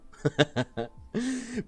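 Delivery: high, light burst of laughter. Real, not acted. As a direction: let out a high giggle. Laughing at the cat purring.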